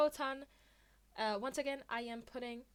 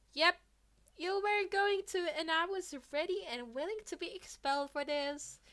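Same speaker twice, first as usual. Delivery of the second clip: put-on higher, louder kid voice; cheerful confession; 8 semitones higher, 6 dB louder than usual. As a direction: proudly own up to it. Midoriya admitting cheerfully.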